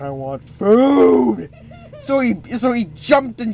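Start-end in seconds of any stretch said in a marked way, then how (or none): 0.0-1.5 slow, drawn-out hungry whine; greedy demand. Acted. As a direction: stretch out the craving. Greedy James demands biscuits.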